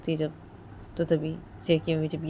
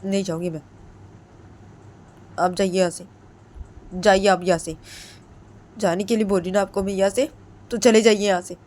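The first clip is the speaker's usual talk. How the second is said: rising insistence, getting louder; stubborn refusal; 3 semitones higher, 6 dB louder than usual. Retold or imitated. Ritu insisting Jiya's mother leave, stubborn and rising. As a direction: dig in and order her out. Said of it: "तो रितु बोलती है".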